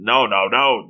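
plain reading voice

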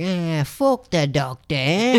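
slow, put-on voice, lifting at the end; mock dismissive. Boyfriend brushing off the doctor's orders to his girlfriend, mock dismissive. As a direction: imitate a guy waving it off, drawn out.

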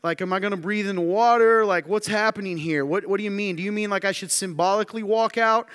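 high, loud, incredulous rush of questions; bewildered questioning. Acted. Preacher playing himself baffled at stepping onto water, incredulous. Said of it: "That's me".

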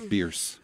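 Bierce rhyming with Pierce; family name pronunciation. Shown as a demonstration. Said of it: "that's how they pronounce it".

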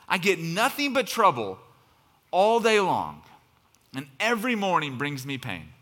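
loud, high anguished crescendo; frustrated outcry. Acted. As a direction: pour out the frustration at full force. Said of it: "in full force and frustration".

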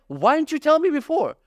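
high-pitched exasperated protest; exasperated complaint. Retold or imitated. Customer protesting to the cashier about cash only, exasperated.

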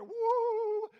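high, loud ghostly wail; long hammed-up spooky howl; mock spooky woo. Imitates a spooky dark figure.

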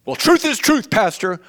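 high, loud indignant protest; mock objector. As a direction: push back at the pastor defiantly. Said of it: "quoted objector".

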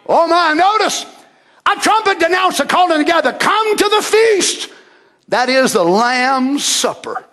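high, ringing call that slows; full-force preaching. Acted. Preacher calling the church to the feast, full-force preaching. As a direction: summon them all, drawing out the words.

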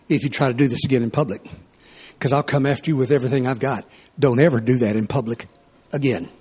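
low, quick, controlled threat; stern warning tone. Retold or imitated. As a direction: warn her low and even, words coming fast. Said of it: "I said it just in this tone of voice".

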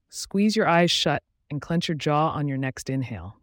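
ordinary talk, nothing marked

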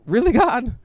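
high, loud mock-exasperated question; imitating an annoyed protest to God; retold annoyance. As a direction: complain upward in disbelief. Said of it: "kind of annoyed".

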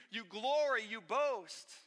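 normal talking voice

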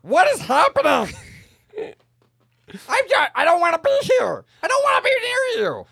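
high, loud frightened pleading; panicked yelling. Acted. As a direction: yell it in a panic. Panicked character shouting at snake.

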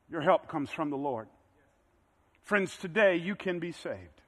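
tender invitation to seekers; gentle altar call. Acted. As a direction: speak quietly to those far from faith. Quiet appeal to listeners.

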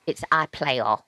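"iPlayer" in an imitated London youth accent; Mixed London English. Host repeating the advert for listeners, "iPlayer" in Mixed London English. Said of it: "and the advert for it is".

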